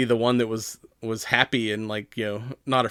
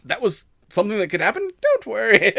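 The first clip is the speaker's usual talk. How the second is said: put-on bubbly Valley Girl; 9 semitones higher than usual. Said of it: "She's like".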